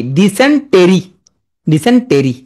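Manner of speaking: ডিসেন্টেরি as di-sen-TE-ree, each syllable weighted; spelling pronunciation. Shown as a demonstration. Teacher preventing a spelling slip, syllable-by-syllable ডিসেন্টেরি. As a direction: sound the hidden e in ডিসেন্টেরি. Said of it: "উচ্চারণ করবা কি?".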